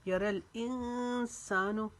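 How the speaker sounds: यारल इंसानु drawn out very slowly, chanted with a rising end; tajweed recitation. Shown as a demonstration. Teacher demonstrates the words for students in slow chant. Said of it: "ज़ेर को हम बारीक पढ़ेंगे".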